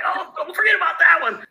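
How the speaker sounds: high, loud mock wife voice; wife teasing him from over there, high and loud. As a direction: needle him from across the room. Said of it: "She's, she's like".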